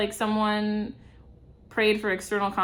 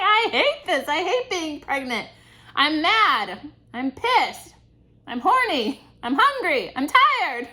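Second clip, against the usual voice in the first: higher, louder mock whining list; complaining; 5 semitones higher, 5 dB louder, slower than usual. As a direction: moan through each complaint. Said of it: "I can almost hear you saying".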